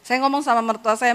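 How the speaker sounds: ordinary delivery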